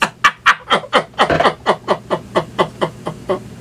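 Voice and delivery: breathless silent laughter; real wheezing laughter. Real, not acted.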